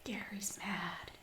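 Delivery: hushed whisper. Real, not acted. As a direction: whisper it so he can't hear. Kid whispers after parent leaves.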